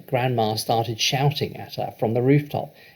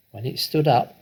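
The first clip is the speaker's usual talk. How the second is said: ordinary delivery, nothing marked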